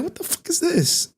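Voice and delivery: retold outraged disbelief; shocked disbelief. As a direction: squeak it out in outrage. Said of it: "I was like".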